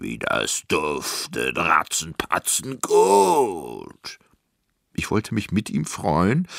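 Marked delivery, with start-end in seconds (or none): 0.0-4.2 slow, long-drawn giant relish; giant savouring smell. A narrator's reading. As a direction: revel in the smell, stretching out the last word. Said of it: "sog die Luft ein".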